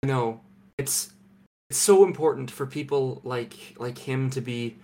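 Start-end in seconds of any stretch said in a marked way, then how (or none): none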